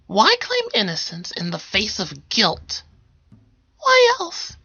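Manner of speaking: high, loud defiant answer; defiant witness. Acted. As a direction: insist on innocence.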